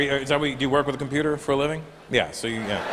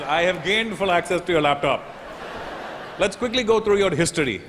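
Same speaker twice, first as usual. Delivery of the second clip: put-on gloating Indian accent; 5 semitones higher than usual. Agent taunts the comedian for his jokes in a gloating Indian accent. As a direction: relish the revenge in an Indian accent.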